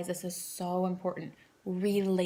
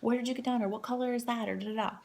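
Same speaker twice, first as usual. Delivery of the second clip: gushing friends; 6 semitones higher than usual. Retold or imitated. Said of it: "all your friends are like".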